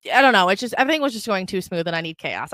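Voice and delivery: normal talking voice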